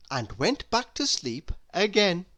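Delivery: plain reading voice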